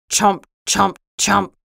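short, punched munching sounds; chomping tree. Acted. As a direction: chomp out each word abruptly. Tree eating the piano.

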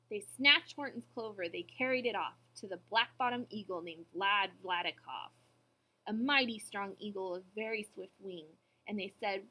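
usual speaking voice

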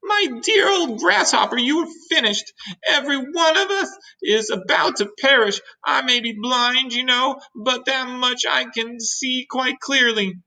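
very high, wailing doom-monger; doom-laden earthworm. Acted. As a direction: pronounce everyone's ruin in high distress. Voices the doom-saying earthworm.

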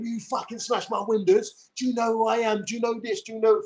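imitating the angry guy he won't be; a hammed-up hint of fury; mock angry rant. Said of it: "I'm not gonna get all angry and start going".

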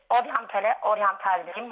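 ordinary delivery

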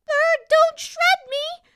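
very high slow squeak. A narrator's reading. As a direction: squeak in panic. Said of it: "the princess squeaked".

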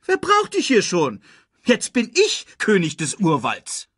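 loud, high, huffy screeching. A narrator's reading. Timon sends Pumba off to play hero, screeching. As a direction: shriek high, easing down a little at the end. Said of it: "kreischte Timon".

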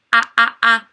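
"A, a, a" as a loud sound drill. Shown as a demonstration. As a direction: hit each "a" short and loud for the class. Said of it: "Can you say it?".

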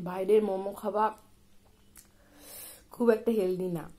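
food in mouth; eating and speaking; chewing while talking. Real, not acted. Seen 4: Bit food at -7s; lips pressed, jaw working while speaking.

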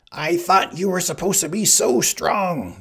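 high, loud berating from the Sicilian; angry shouting. A narrator's reading. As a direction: shout the accusation, pitched high. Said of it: "the Sicilian shouted".

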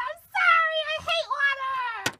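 high, stretched-out panicky cry; panicked pleading. Acted. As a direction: wail the apology in terror of water. Threatened with drowning in bathtub.

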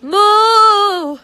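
loud, high, long moo; loud cow moo. Pretend. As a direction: belt out a big moo. Moos to the crowd.

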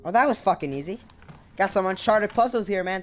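ordinary talk, nothing marked

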